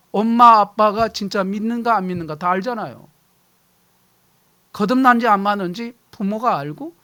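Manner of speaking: ordinary talk, nothing marked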